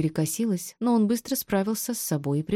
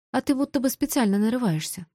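higher-pitched, slightly huffy jab; mildly grumpy retort; 5 semitones higher than usual. A narrator's reading. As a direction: toss it back, a touch grumpy. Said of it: "буркнула я". (слегка ворчливо, с вызовом)

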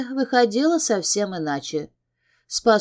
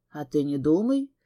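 smirking; 5 semitones lower than usual. Acted. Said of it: "вновь усмехнулся Джеймс".

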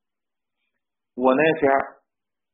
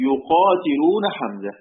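slow verse recitation; 3 semitones higher, slower than usual. Shown as a demonstration. Teacher reading the Shatibiyya line aloud, drawn out. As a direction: stretch يقاتلون like a recited poem line. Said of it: "قال".

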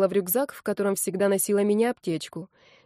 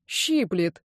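whining. A narrator's reading. Injured guy complaining of the antiseptic, slight whine. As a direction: complain like it stings. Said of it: "ныл Кизон".

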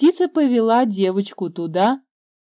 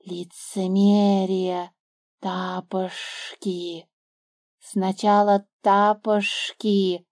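slow, drawn-out serpent voice; the snake mocking the girl, slow and scornful; 3 dB quieter, much slower than usual. A narrator's reading. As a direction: sneer it out slowly like an old snake. Snake character voice.

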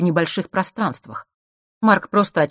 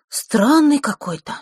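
high, drawn-out puzzlement; slow, raised-pitch bewildered remark; puzzled wonder; 10 semitones higher, 6 dB louder, slower than usual. A narrator's reading. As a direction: marvel slowly at the odd thing. Brother puzzles over the strange key.

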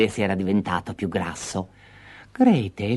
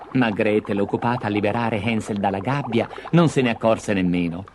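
plain reading voice

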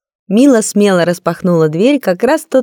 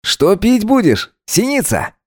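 put-on casual young man; 3 semitones higher, slower than usual. Vova's voice continues.

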